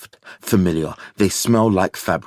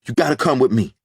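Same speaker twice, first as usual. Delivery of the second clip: urgent plea; 5 semitones higher, faster than usual. A narrator's reading. Mateo urges them, panting.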